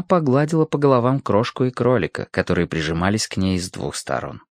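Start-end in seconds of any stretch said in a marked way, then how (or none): none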